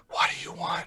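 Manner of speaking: hushed secret whisper. Acted. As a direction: whisper it so only she hears. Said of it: "Well, I'm gonna whisper it".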